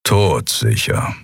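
low, slow grumbling growl. A narrator's reading. Keru growls his certainty to Lien, low and slow. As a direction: rumble it like a gruff beast. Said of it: "brummte Keru".

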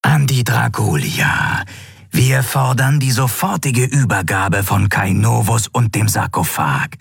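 a hint of hiss, cold and unmoved; hissing disembodied voice. A narrator's reading. Cultist voice demanding the surrender from the ship, cold and hissing. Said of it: "zischte die körperlose Stimme".

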